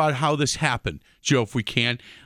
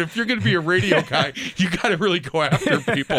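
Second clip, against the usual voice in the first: station boss teasing the new host, mock yelling; mock tough-boss yell; 6 semitones higher than usual. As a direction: bark it at the rookie with a laugh.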